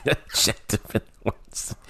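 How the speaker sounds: slow, breathy laughing repeat; laughing echo. Real, not acted. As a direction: chuckle the words out slowly. Mock echo of co-host's phrase.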